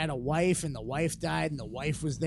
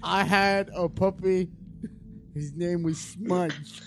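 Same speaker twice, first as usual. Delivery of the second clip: mock silly voice; 3 semitones higher, slower than usual. Joking put-on voice.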